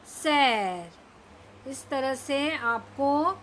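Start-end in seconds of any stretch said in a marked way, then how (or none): 0.1-1.7 सैर drawn out very slowly; slow reading. Shown as a demonstration. Teacher showing kids to read letters slowly. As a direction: stretch सैर out long. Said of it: "सारे अक्षर धीरे धीरे पढ़ने हैं".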